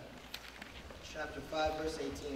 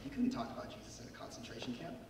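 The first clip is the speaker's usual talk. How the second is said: whisper; 6 semitones lower, 9 dB quieter, faster than usual. Acted. Secret faith in the camp.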